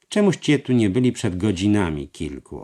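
voiced master. A narrator's reading. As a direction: weigh each word, uneasy. Master speaks. (mistrz mówi niechętnie, głośniej)

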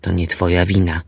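low, grave and calm; steady him, reassuring her gravely; serious reassurance. Acted. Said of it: "patrząc na nią z powagą".

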